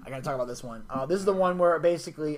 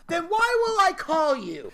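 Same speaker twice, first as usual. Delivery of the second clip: high, loud protest; raised-voice mock exasperation; exasperated; 10 semitones higher, 6 dB louder, slower than usual. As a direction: stretch it out as an incredulous objection. Acts out exasperated objection.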